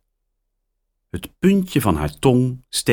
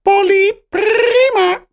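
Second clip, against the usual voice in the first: very high squawking parrot; 6 dB louder than usual. A narrator's reading. Parrot Polly squawks hallo, very high. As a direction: screech it like a parrot. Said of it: "roept Polly".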